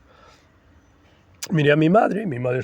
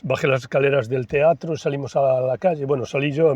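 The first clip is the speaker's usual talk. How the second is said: ordinary delivery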